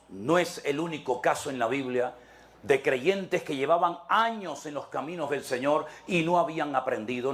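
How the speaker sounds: ordinary delivery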